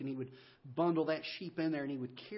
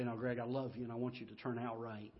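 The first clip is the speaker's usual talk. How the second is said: much softer, tender fatherly comfort; tender dad; 5 semitones lower, 7 dB quieter than usual. Retold or imitated. Father comforts crying son after the whipping, tenderly. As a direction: hold him close and say it softly.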